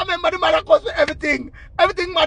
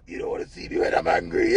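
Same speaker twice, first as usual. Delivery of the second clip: softer, strained mock menace; hammed-up threat before cracking up; angry man; 4 semitones higher, 6 dB quieter than usual. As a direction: imitate the angry man's threat. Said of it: "what kind of angry man this".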